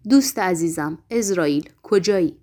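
ordinary delivery, nothing marked

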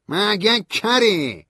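high, loud, very slow snap; angry retort. A narrator's reading. Stanley asks if narrator is deaf, angry retort. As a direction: bite the words off.

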